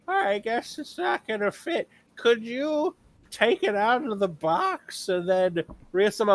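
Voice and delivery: high, slow, clueless customer voice; mock customer voice. Worker mimicking a clueless customer, high and slow. Customer quoted in retail story.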